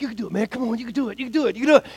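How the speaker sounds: put-on softly urging the kid on under the breath; root for him quietly, almost to yourself; quiet cheering on. Lifeguard quietly urging struggling kid, hushed encouragement.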